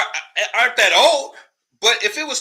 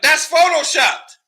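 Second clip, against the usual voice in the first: mock quote; 6 semitones higher, 5 dB louder, slower than usual. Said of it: "y'all like".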